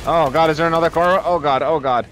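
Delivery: loud high panic, fast; panicked. Real, not acted. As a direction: blurt it out in panic. Another car attacks, panicking.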